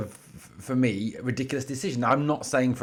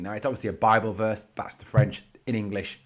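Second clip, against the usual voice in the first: usual speaking voice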